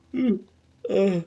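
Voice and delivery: talking through a yawn. Real, not acted. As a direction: yawn while speaking. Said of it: "I don't know why I'm yawning".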